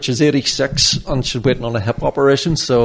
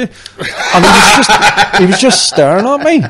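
laughing; 6 semitones higher, 6 dB louder than usual. Real, not acted. Laughing while retelling.

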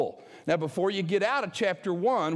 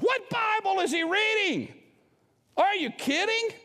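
can you believe this guy, pitched way up; incredulous outburst; 13 semitones higher than usual. Real, not acted. Outraged reaction to Bill Maher.

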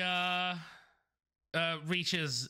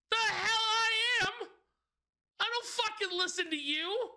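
defiant shout. Acted. Tiefling refuses angrily.

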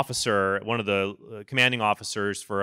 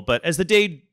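ordinary talk

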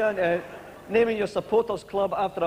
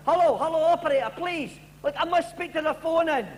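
desperate caller; 7 semitones higher than usual. Acted. Late caller begging to speak.

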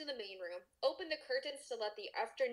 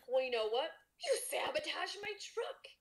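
gasped accusation; 5 semitones higher than usual. Acted. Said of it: "Riley gasped".

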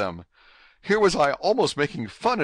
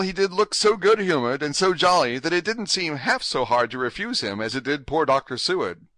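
plain reading voice

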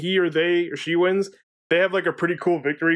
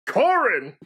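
announcer voice; 5 semitones higher than usual. Retold or imitated. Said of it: "one of us just".

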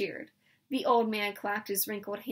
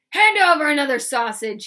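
much louder, higher roared command; loud demand; 4 semitones higher, 12 dB louder than usual. A narrator's reading. Laban shaking the old man, loud demand. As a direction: roar the demand. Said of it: "he demanded".

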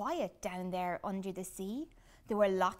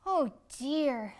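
disappointed sigh; 4 semitones higher, 7 dB louder than usual. Acted. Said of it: "sighed Flip-Flop".

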